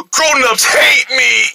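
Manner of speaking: slow, high mock wail; hammed-up crying. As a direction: bawl it out like a hurt child. Scolded boy wails.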